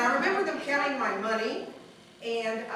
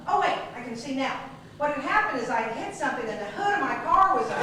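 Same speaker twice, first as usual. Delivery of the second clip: ordinary talk, nothing marked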